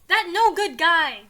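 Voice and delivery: loud, slow angry burst. A narrator's reading. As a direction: punch it out in mock anger. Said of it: "Joe says angrily".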